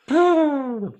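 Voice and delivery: big excited gasp; sharp gasp. Acted. Countdown begins.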